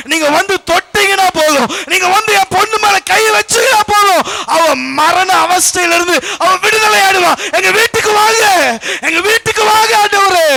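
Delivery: high, loud, impassioned begging; crying out. Acted. Father pleading for Jesus to come home, crying out. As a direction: wail the plea at full force. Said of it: "நீயும் அப்படித்தானே கதறி கொண்டு இருக்கிற".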